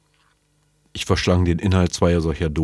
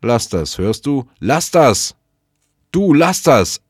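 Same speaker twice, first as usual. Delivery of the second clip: building from firm to a loud, much higher shout; raised voice; 9 semitones higher, 9 dB louder, slower than usual. Acted. Snout shouting at someone off screen, building to a loud yell. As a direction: snap at her louder each time, ending in a full yell. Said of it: "erreichte mich Snouts erhobene Stimme".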